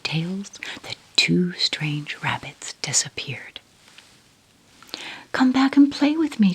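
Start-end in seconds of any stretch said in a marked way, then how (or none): 5.3-6.5 high, voiced, louder call after them. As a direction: call out to the vanishing rabbits. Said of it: "called the little rabbit".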